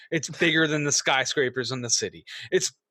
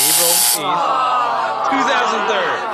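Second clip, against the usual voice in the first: high dramatic reveal; 8 semitones higher, 7 dB louder, much slower than usual. Acted.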